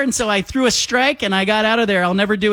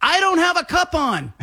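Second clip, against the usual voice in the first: imitated panicked yell; 9 semitones higher than usual. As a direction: yell it in a high voice as the horrified catcher. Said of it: "and he yells".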